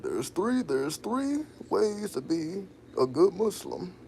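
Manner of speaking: high, loud, slow lecturing tone; preachy son. Retold or imitated. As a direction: lay it down like a little preacher. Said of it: "He like".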